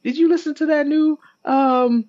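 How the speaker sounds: put-on very high-pitched kid voice; squeaky-high mock child; excited schoolkid. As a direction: play a kid hyping it up to friends. Kid on playground quoted.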